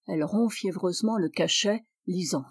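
ordinary talk

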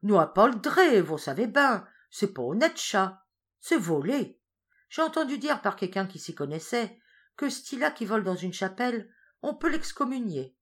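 put-on sly farmer scolding the old woman about theft. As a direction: lecture her with relish. Farin moralizing.

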